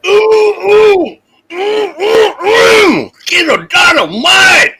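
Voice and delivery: high shouted whoops of delight; excited whoops. Real, not acted. Host whooping at a stream shot, high excited shouts.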